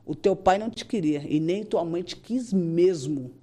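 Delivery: ordinary delivery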